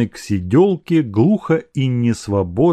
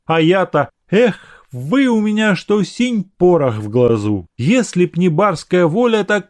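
higher-pitched jealous servant, pleading; lightly voiced pleading suitor; 7 semitones higher than usual. (ревнивый слуга, слегка умоляюще)

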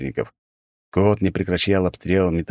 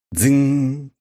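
metal clang; 8 semitones higher than usual. A narrator's reading. Sound of ball hitting helmet.

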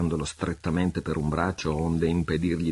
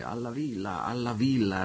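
insistent repeat; 6 semitones higher than usual. A narrator's reading. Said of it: "rispondeva invariabilmente il pirata".

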